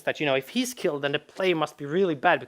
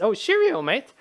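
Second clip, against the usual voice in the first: high, loud chummy sidekick greeting; cheery rabbit companion; 6 semitones higher, 4 dB louder than usual. Acted. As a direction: play a chirpy rabbit greeting its pal. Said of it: "and the rabbit says".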